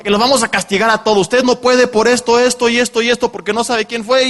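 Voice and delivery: retold scolding. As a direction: bark it out like a stern official laying down the rule.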